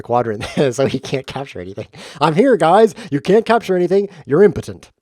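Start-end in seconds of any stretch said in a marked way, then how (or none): 2.2-4.9 loud, high-pitched taunt; crowing mock bravado; playful taunting. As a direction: jeer at the opponent's pieces. Taunting opponent as the chess piece.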